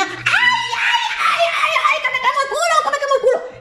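very high laughing yelps; wolf scorched in the fireplace; burnt wolf yelping. Acted. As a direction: howl in comic pain. Wolf falls into the fire.

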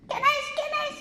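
very high piggy question; high piglets asking who's there; squeaky piglets. A narrator's reading. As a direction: squeak it like tiny pigs. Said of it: "los cerditos dijeron".

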